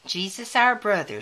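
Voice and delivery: ordinary delivery, nothing marked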